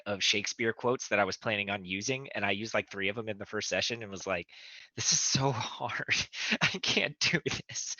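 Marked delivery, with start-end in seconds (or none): 5.0-8.0 high, laughing whine of giving up; mock despair. Acting out own overwhelmed complaint.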